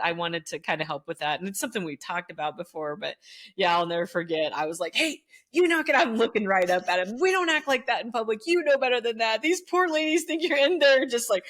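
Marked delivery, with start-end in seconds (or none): 4.9-11.1 high, loud teacher telling-off; retold stern teacher scolding. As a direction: look up at him and scold. Scolding teen again.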